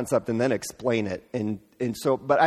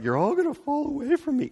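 much higher, wavering mock-tragic wail; mock sad and scared. Jesus to disciples, the wrong way: mock sad lament. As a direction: ham up a doom-laden farewell. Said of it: "he's not, like, sad or scared".

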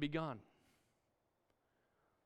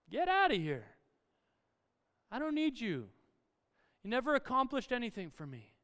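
higher-pitched, acted rejection; dismissive banishing; 6 semitones higher, 3 dB louder than usual. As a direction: send the gremlin packing.

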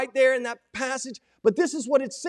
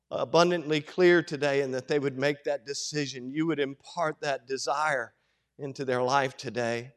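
low, measured prayer voice; opening prayer; 9 semitones lower than usual. Real, not acted. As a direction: pray earnestly in a lowered, reverent tone.

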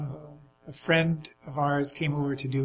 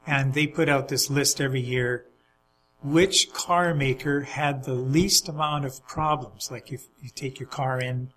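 ordinary delivery

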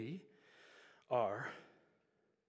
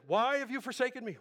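loud, high cry of abandonment; anguished cry; 10 semitones higher, 9 dB louder than usual. Acted. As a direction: cry it out to a silent God. Quotes Jesus' cry on the cross.

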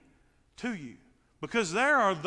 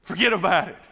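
usual speaking voice

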